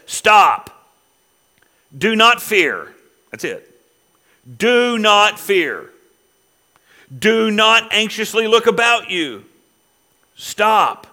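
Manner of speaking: loud, high, slow and distinct, each word set down; confrontational command. Acted. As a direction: command them to stop, firmly. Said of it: "very distinctly and confrontationally says".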